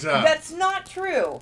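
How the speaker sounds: high, loud, slow objection; loud indignant protest. Real, not acted. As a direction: push back hard and stretch it out. Cohost protests his verdict.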